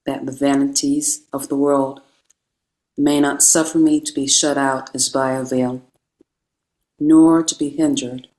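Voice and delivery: normal talking voice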